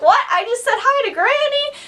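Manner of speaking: high, incredulous echo; retold astonishment. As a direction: replay your own stunned realisation. Own astonished reply, retold.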